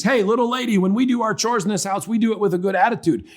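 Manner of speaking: high-pitched mock lecture to a child; scolding parent. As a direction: lay down the law like an exasperated parent. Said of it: "if you had done it with my instincts".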